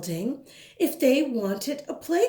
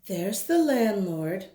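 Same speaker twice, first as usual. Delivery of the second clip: plain reading voice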